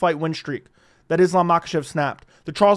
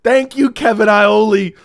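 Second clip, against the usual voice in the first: loud thanks; 3 semitones higher, 7 dB louder than usual. Real, not acted.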